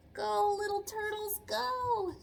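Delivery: high excited cheer, rising on the last go; root for the baby turtles to reach the sea. Acted. Teacher cheering the hatchlings on for her class.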